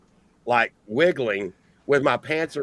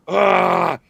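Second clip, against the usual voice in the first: loud, raised open-mouthed "aah"; mock roar; 5 semitones higher, 7 dB louder than usual. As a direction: imitate the cousin's wild yell. Cousin about to bite the door.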